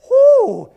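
very high, loud whoop; excited whoop. Real, not acted. Preacher celebrates the trick play with a high whoop. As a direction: let out a big stadium cheer.

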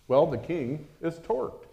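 ordinary talk

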